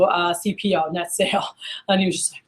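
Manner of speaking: usual speaking voice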